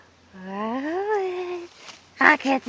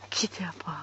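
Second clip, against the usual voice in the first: whispering; 8 semitones lower, 11 dB quieter, faster than usual. Real, not acted. Urging dog, whispered.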